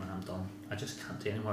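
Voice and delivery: low, soft retold resignation; understated, downcast inner voice; defeated. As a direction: recall giving up, quietly and wearily. Retells despair after losing.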